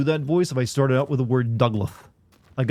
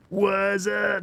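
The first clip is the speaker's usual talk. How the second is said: much higher, chirpy slang greeting; high slangy greeting; 9 semitones higher than usual. Retold or imitated. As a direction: pop it up high like a hype kid. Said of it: "I don't go like".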